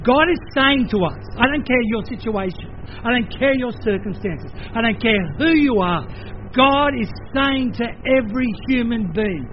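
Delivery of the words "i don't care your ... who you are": ordinary talk, nothing marked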